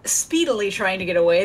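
ordinary talk

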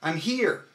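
here punched from the core, I'm left light; I'm here with the weight on here; stress on here. Shown as a demonstration. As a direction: tighten the stomach on here. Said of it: "but on here".

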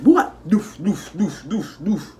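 hammed-up Jamaican dancehall noises, up high; mock Jamaican sounds. Joking about Jamaicans fighting, hammed-up high Jamaican noises. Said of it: "how it be sounding".

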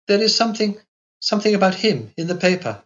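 lightly voiced worried Carlotta; uneasy young girl. A narrator's reading. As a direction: she guesses something is wrong. Girl senses bad news.

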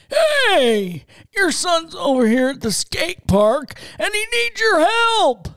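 put-on high, loud old-man prank caller; crotchety old codger calling out. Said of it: "prank call as an old man and say".

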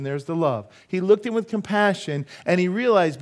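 high, loud, driving preaching; forceful preaching. Acted. Preacher telling of the rich young ruler, forceful preaching. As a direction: preach the story out, pitch held up.

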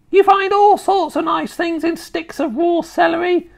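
high, loud grandma voice; gleefully nasty grandma. Acted. Grandma pushing caterpillars on her grandson, relishing.

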